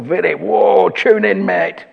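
high, loud call to pay attention; urgent self-alert. Retold or imitated. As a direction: call out as if jolting yourself awake. Urgent alarm to himself in thought.

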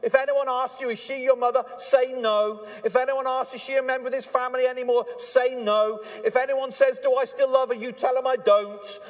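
high and loud, done with her; fed-up father. Pretend. Hosea telling the children to disown their mother, indignant and loud. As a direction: declare it to the kids, firm and indignant. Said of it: "turn around to the children and say".

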